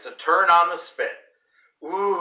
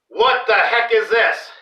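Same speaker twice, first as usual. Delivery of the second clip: loud, high angry bellow; dad bellowing at his son over the roast; 6 semitones higher, 5 dB louder than usual. A narrator's reading. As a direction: roar it at the table. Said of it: "he bellows".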